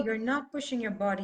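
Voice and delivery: ordinary delivery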